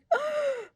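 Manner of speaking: high, dramatic mock gasp; sharp intake of appalled breath; shocked gasp. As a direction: gasp in exaggerated horror. Acts out shocked reaction to child.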